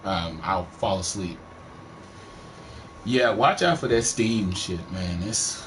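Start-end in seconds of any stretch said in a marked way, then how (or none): none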